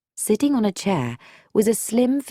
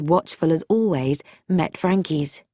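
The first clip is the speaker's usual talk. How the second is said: ordinary delivery, nothing marked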